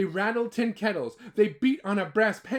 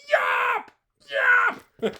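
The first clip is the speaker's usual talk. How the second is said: very high, loud squeaky shouts; shouted yawp; 8 dB louder than usual. Acted. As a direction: yell it like a tiny boy on a tower. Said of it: "Yell, yell with me".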